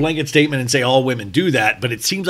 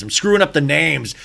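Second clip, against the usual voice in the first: ordinary talk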